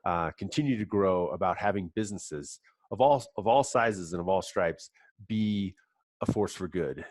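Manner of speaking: ordinary delivery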